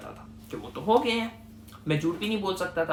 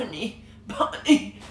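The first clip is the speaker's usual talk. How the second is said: fake weak coughing; 3 semitones higher, slower than usual. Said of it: "acting ताकि मुझे होश आ रहा है".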